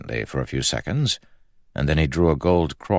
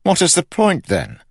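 high, loud grumble; raised royal voice, dropping on the last word; grumbling king; 6 dB louder than usual. A narrator's reading. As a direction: complain as a frustrated king. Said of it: "grumbled the king".

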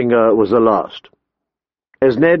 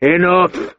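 bellowed; 6 semitones higher than usual. A narrator's reading. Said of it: "the king bellowed".

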